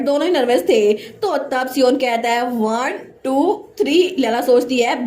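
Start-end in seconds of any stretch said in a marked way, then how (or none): 2.5-4.2 slow count to three. Acted. Sion counting down so both stand up, slowly. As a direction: draw out one and two, then snap three.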